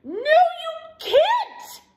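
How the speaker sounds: high, loud drawn-out protest; yelp. A narrator's reading. Douglas protesting to the bird, high loud yelp. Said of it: "yelped Douglas".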